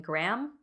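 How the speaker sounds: Graham squashed into a single "gram"; one-syllable Graham. Shown as a demonstration. Wife admits how she gets teased for saying it, one-syllable Graham. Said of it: "get made fun of ... for saying Graham".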